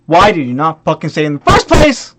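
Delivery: "first place" punched hard; furious emphasis. Retold or imitated. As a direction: build to an exasperated "first place". Third, emphatic repeat of complaint.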